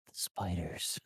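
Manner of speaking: low, ominous one-word intone; Peter Parker. Retold or imitated. Spider-Man threatening a gunman, low ominous. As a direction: drop your voice and menace. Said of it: "Peter Parker just goes".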